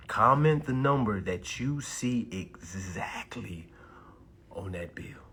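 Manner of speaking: slow, hushed, low instruction; real slow. Real, not acted. Streamer telling viewers what to comment, real slow. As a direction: spell it out slowly and softly. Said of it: "Let me say it real slow".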